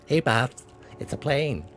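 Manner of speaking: Hey boss impression. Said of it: "That was a pretty good impression".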